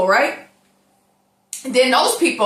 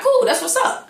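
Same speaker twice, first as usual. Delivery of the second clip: high chirpy quote; 13 semitones higher than usual. Retold or imitated. Mock cheerful quote.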